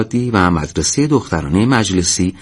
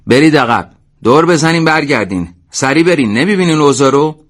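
raised, higher-pitched yelled order; shouting; 6 semitones higher, 5 dB louder than usual. A narrator's reading. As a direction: yell at them to get back. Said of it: "داد زد". (با داد)

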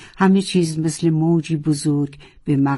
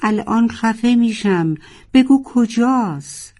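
frantic shout, slower at the end; 6 semitones higher than usual. A narrator's reading. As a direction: scream it, choking with panic. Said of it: "و فریاد زد".

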